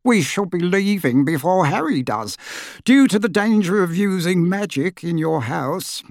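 a little wizard bowing deeply; fussy little wizard. A narrator's reading.

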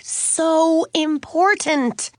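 high, drawn-out "So important"; emphatic. A narrator's reading. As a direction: stretch "So important" slowly for emphasis. Katie insists the concert matters.

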